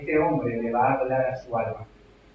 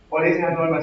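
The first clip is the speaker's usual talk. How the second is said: normal talking voice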